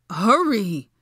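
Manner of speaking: loud, frantic command; desperate shout; desperate yell. A narrator's reading. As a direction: shout it like there's no time. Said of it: "he repeated desperately".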